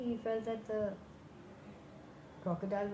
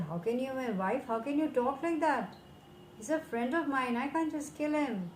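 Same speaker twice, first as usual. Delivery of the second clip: shocked crocodile protest; 6 semitones higher than usual. Acted. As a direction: plead against the cruel idea, raised up high. Crocodile protesting wife's demand.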